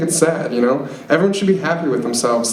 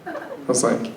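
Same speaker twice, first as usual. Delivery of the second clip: usual speaking voice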